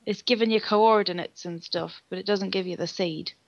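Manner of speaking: usual speaking voice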